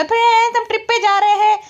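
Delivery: high-pitched teasing quote; mock excitement. Vlogger mimicking the excitement over a local trip, mock excitement.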